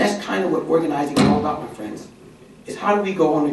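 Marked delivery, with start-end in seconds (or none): none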